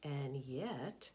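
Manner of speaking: ordinary delivery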